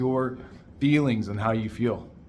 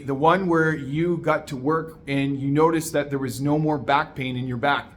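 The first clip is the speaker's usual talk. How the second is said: normal talking voice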